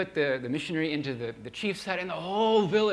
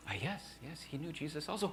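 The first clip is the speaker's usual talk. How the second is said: much softer, hesitant answer; sheepish quiet reply; 10 dB quieter than usual. Retold or imitated. Missionary answers the old shaman, soft and sheepish.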